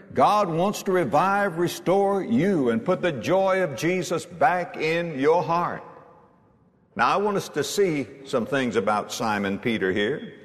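ordinary delivery

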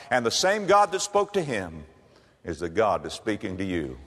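normal talking voice

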